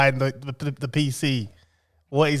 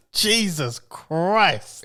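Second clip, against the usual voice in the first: slow exasperated; much slower than usual. Real, not acted. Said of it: "exasperated at friend".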